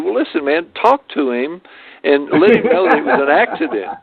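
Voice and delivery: lightly acted appeal for mercy; mock pleading. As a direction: plead your case, half laughing. Retold plea to call off rival.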